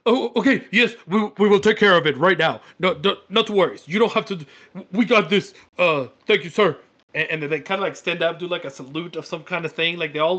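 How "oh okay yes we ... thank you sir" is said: loud, higher, eager-to-please kobold voice; loud, flustered minion voice. Acted. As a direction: babble reassurances like a nervous underling. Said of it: "and they're like".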